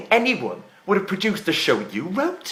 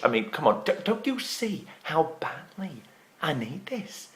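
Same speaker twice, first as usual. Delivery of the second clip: very soft, low, desperate begging; subdued, breath-held coaxing; hushed pleading; 5 semitones lower, 10 dB quieter than usual. Acted. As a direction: beg gently, almost down to a whisper. Desperate plea to Kim to stay.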